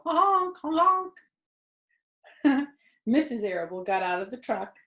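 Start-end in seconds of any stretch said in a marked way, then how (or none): 0.0-1.1 high loud honk honk; goose honks. Shown as a demonstration. Reader showing the kids how geese cheer. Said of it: "How would a, a goose cheer? They'd go".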